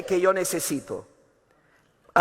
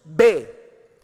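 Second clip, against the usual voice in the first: command; 4 semitones lower, 5 dB louder than usual. Retold or imitated. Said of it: "le está dando, ¿qué? Orden".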